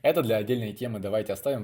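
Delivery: ordinary delivery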